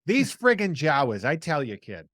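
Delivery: playing up a Boston guy's accent. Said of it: "say it the way I say it".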